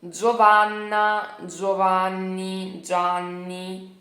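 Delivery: careful Italian names; Italian pronunciation. Shown as a demonstration. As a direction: stretch each Italian name slowly. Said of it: "então, vira como?".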